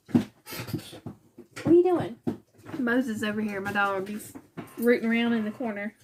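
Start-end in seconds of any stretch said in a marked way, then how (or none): 0.1-2.2 very slow, high sing-song question to the dog; drawn-out to the dog. Real, not acted. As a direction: stretch every word out for the dog. Talking to her dog again.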